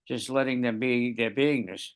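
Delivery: usual speaking voice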